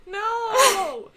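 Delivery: high, loud outraged No; loud protest. Real, not acted. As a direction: cry out in protest. Protesting the edit.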